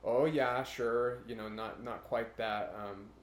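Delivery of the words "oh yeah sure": put-on loud, folksy Nebraska Midwest accent. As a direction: sound like a cheerful Nebraskan. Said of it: "you start to hear a little bit more of that".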